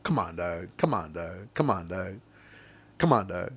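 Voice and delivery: low, soft, weary appeal; hushed, deflated disbelief; quiet dismay. Real, not acted. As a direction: say it low and under your voice, disappointed. Dismayed at officer's retold words.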